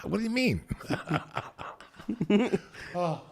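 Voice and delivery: mimicking him through a laugh. Real, not acted. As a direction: echo his question while laughing. Laughing at co-host.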